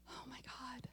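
breathless whispered awe; shocked whisper. Retold or imitated. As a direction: whisper it in disbelief. Shocked as they all nod.

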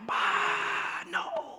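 demon. Pretend. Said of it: "if you're a demon".

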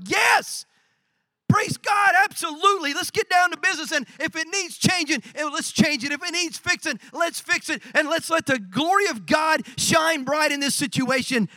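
high, fired-up preacher's cheer; exuberant agreement. Acted. Pastor cheering on people who bring needs, exuberant. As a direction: burst out high and rally them to act. His delighted reply to eager helpers.

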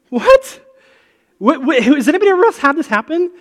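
squeaky high, loud and fast; blurting out in pure disbelief; retelling a stunned yelp at the pilot's announcement; shocked disbelief. Retold or imitated. Retold shocked reaction to announcement.

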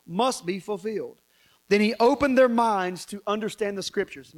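loud, projected Bible reading; scripture reading. Real, not acted. Preacher reading Jesus's words to congregation, forceful scripture reading. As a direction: declaim the verse with force.

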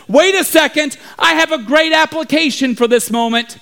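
high, grand mock proclamation; brave Bible student. Bible student rallies the panicking town, brave proclamation. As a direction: proclaim it heroically, drawing out the warnings. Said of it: "one brave s-, Bible student stands up and says".

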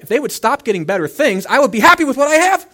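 high, loud mock yell, building; exasperated outburst. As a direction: blow up in acted frustration. Acts out resentful neighbour blowing up.

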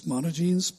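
"monogenes" as MON-o-jeans, rising at the end; anglicized misreading. Shown as a demonstration. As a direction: rhyme "monogenes" with skinny jeans. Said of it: "Skinny jeans".

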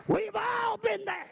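shouted sermon peak; preaching. Acted. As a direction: lift it to the congregation. Preacher rises after repeats.

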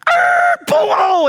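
high, loud screech into a boom; vocal brakes and bang, acted out; crash imitation.

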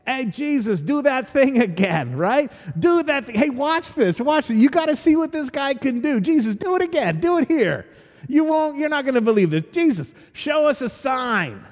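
high, excited heckler calling out; heckling crowd. Retold or imitated. Crowd heckling Jesus for another miracle, high excited calls. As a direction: egg him on like a rowdy fan. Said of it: "They begin to shout out things like".

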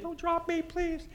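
high, pleading voice of a frightened man; scared plea. Retold or imitated. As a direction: beg not to be dropped. Scared man being lowered down.

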